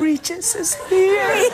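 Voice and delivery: slow, high, mock-creepy wail; drawn-out horror-trailer voice. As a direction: stretch it out spookily for a horror trailer. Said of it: "in the trailer you'd have that".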